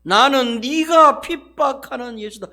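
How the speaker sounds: slow, loud, deliberate with stress on 네가; preacher slows it down so the church can repeat; slow emphatic repeat. Shown as a demonstration. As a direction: spell out 네가 핍박하는 예수다 slowly for them to repeat. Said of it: "따라합니다".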